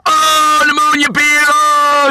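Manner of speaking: put-on very high, loud childish wonder; a grown man gasping like a child; little kid voice. Tony's little-kid voice continues.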